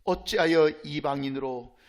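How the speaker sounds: ordinary talk, nothing marked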